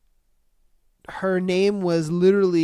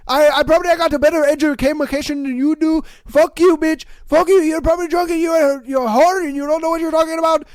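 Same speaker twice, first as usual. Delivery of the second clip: high, loud shouted fury; over-the-top angry caller rant; mock furious rant; 9 semitones higher, 7 dB louder, faster than usual. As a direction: yell it back at the drunk caller, way up high. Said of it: "I'd be like".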